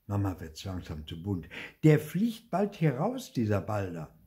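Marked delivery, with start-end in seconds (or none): 1.8-4.1 a touch of a cross mother losing patience; scolding. Acted. Mother scolding about the imaginary brother, mildly cross. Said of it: "schimpft sie".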